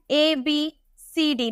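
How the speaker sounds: ABCD drawn out slow and soft like a chant; slow recitation. Shown as a demonstration. Teacher recites the alphabet to students, slow and soft. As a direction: recite ABCD slowly as learnt in childhood.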